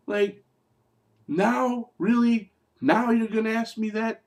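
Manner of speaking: can't believe the timing, pitch way up; incredulous. Real, not acted. Incredulous reaction to wife's question.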